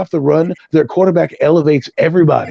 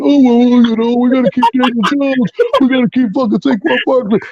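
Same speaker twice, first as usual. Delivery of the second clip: higher, whiny mock voice of complacent fans; 7 semitones higher than usual. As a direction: sneer at the fans by voicing them. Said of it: "dawdling around".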